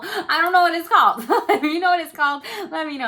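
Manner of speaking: high, loud giggly laughter through the words; giggling at not knowing the name; laughing. Real, not acted. Laughing at forgetting a creature's name.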